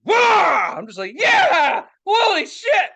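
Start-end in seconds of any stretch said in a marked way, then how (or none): 0.0-0.7 loud, high "wah" roar; imitated engine roar. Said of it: "and you hear this". 1.2-2.9 high, loud whoop of excitement; thrilled yell. Retold or imitated. Reenacts thrill at engine roar.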